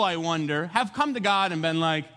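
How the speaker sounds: ordinary talk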